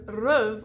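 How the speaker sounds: rêve mispronounced with a rounded "uh" vowel; rêve with a dull central vowel; wrong vowel. Shown as a demonstration. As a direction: say rêve as "ruv". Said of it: "Instead of rêve or rêve".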